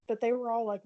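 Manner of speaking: ordinary talk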